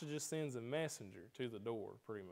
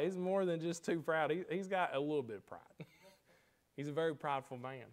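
normal talking voice

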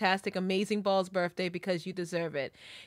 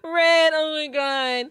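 tearful wail; 7 semitones higher, 8 dB louder, slower than usual. Real, not acted. Overcome on seeing next friend's message.

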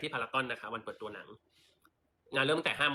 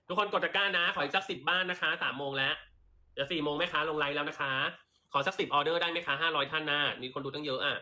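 higher, brighter sales call to viewers; live sale pitch; 4 semitones higher than usual. Acted. Seller urging live viewers to tap the basket, upbeat sales pitch. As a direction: coax the viewers to buy.

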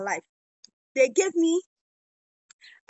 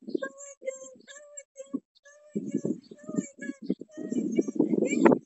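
giddy shrieks of joy, each one higher; high excited squeals; 10 semitones higher than usual. Real, not acted. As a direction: squeal it over and over, high and thrilled.

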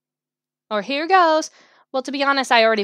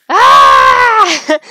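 loud scream of bottled-up anger; sudden loud venting scream; angry scream; 4 semitones higher, 13 dB louder than usual. Acted. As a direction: let pent-up anger burst out in a yell. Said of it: "all that anger bottled up".

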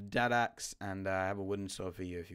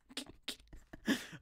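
breathy giggle; 11 semitones higher, 6 dB quieter than usual. Real, not acted. Laughs at misread name.

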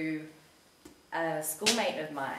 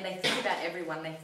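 ordinary delivery

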